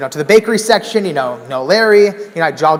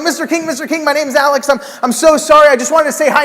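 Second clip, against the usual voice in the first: much higher, breathless gushing; higher-pitched flustered greeting; nervous excited fan; 9 semitones higher than usual. Retold or imitated. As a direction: babble like a starstruck fan.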